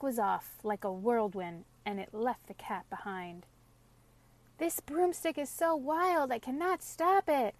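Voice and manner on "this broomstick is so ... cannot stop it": high, far-off witch call. A narrator's reading. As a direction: shout down from the sky. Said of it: "called the witch from the clouds".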